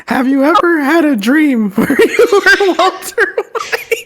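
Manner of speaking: put-on high, slow old lady reading; Doris old lady voice. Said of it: "read it in her old lady voice".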